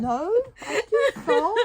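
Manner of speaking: high, loud, slow denial broken by laughter; laughing denial. Retold or imitated. Retelling her denial to her husband, laughing. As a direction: laugh through the fib you told him.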